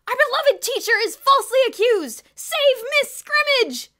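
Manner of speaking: very high, very loud rallying cry; booming PA voice. A narrator's reading. Kathy over the school PA, booming and rallying. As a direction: boom it over a loudspeaker. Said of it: "voice boomed over the school's public address system".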